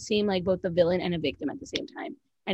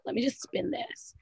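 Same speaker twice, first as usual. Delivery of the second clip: breathy conspiratorial whisper; scheming whisper; 9 dB quieter than usual. Retold or imitated. Erica plotting her car story, whispered. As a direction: whisper it like a plot.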